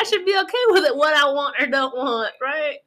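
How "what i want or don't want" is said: normal talking voice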